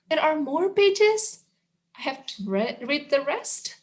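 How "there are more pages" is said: high dismayed question. Pretend. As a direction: whine in dismay. Dismay at more pages.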